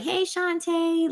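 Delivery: high, slow sing-song hello; greeting a child. Retold or imitated. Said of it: "looking at a child and being like".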